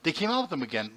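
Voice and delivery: ordinary talk, nothing marked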